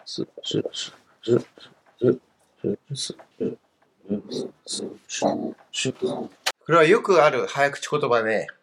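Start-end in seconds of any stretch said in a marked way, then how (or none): 0.0-5.9 quietly voicing each す as the pinyin is written; soft pinyin read-out. Shown as a demonstration. Teacher softly voices pinyin while writing it out. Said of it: "ピンも書いてみましょうか".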